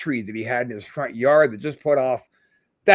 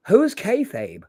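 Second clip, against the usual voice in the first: clueless question; 6 semitones higher, slower than usual. Retold or imitated. Clueless sidekick asks question.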